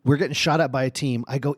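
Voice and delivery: ordinary talk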